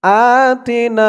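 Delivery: Arabic recitation; careful recitation. Shown as a demonstration. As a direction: recite আতিনা slowly, the opening আ short. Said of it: "এক আলিফ টান হবে".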